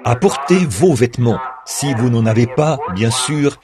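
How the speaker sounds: usual speaking voice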